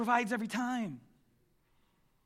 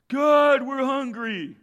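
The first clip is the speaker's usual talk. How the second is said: mock whining plea, raised and louder on God; whiny complaint; 5 semitones higher, 8 dB louder, much slower than usual. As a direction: imitate the grumbling crowd begging. Complaining Israelites voiced.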